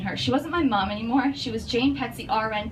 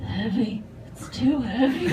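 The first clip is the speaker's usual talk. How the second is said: weak sick dad; 3 dB quieter, slower than usual. Retold or imitated. Sick dad complains weakly.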